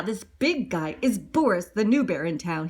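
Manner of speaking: usual speaking voice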